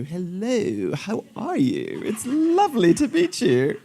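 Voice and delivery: playful put-on English accent, rising high at the end. Surgeon greeting an English patient in an English accent. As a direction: greet him like a polite Englishman. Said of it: "if the patient's from England".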